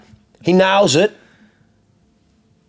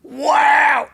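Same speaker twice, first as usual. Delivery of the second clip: amazed wow, pitch jumping much higher; retold wow; 10 semitones higher than usual. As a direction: blurt it out like the car just blew you away. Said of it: "we're both like".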